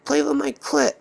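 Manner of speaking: high-pitched complaining woman; Sarah. Retold or imitated. Woman mad that [name] stopped, high mock complaint. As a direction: whine it high as a put-out woman. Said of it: "she would always get mad when I stopped".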